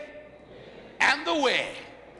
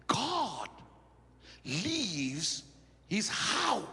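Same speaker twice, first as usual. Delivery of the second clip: hushed, deliberate preaching; 3 semitones lower, 7 dB quieter than usual. Acted. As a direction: let each word land quietly. Preacher slowly reveals the point.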